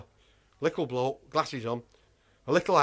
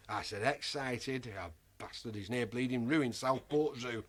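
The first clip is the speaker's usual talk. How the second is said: hushed grumble; 6 semitones lower, 8 dB quieter than usual. Retold or imitated. Bickering with wife in sandpit.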